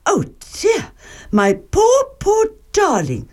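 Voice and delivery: high-pitched, cooing sympathy; fussing storybook mother voice; consoling Mother Bear. A narrator's reading. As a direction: soothe a tearful little cub. Mother Bear comforts Baby Bear.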